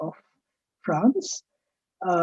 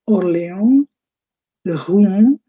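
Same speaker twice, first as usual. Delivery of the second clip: ordinary talk, nothing marked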